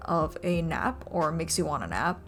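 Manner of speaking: ordinary talk, nothing marked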